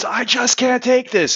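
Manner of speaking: high-pitched mock scream at normal volume; imitated scream. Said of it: "he screams".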